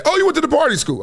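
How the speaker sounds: high-pitched mocking voice; mock disdain. People judging his choice of college, high mocking voice.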